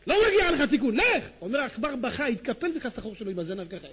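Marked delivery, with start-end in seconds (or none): 0.1-1.2 high, loud rebuke; acted angry dismissal; stern banishment. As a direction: send the wicked one away sharply. Rabbi banishing the mouse.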